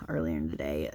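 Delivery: hushed late-night whisper; tired whisper, as if not to wake anyone; whispered aside. Real, not acted. Late-night aside while yawning.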